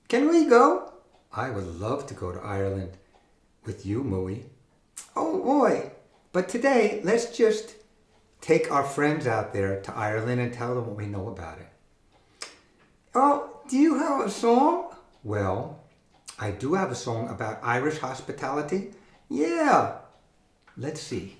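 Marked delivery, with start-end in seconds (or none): 0.1-0.9 high squeaky puppet reply. Acted. 5.2-7.7 high squeaky puppet reply. Acted. 13.1-14.9 high squeaky puppet reply. Acted. 19.3-20.0 high squeaky puppet reply. Acted.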